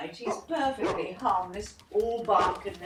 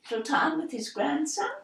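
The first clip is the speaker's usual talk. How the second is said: normal talking voice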